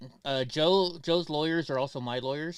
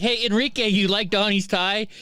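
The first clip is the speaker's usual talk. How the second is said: teasing call out; 6 semitones higher, 5 dB louder than usual. Retold or imitated. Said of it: "and I'm like".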